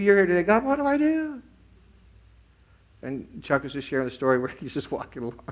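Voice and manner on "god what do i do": retold plea. Woman asks God what to do, pleading high. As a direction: act out a helpless cry to God, pitched up high. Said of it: "she's like".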